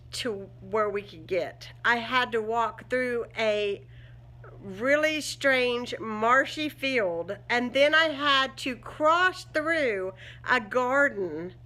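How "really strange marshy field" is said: ordinary talk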